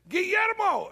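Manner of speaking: high, drawn-out street call; imitating a man calling out GUILLERMO; hollered name. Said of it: "a guy hollered".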